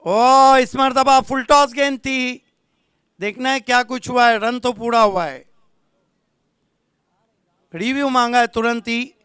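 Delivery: loud exclamation settling into urgent match call; live play-by-play. Real, not acted. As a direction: react with a loud "ओ", then report the play keenly. (जोशीली आँखों-देखी cricket commentary)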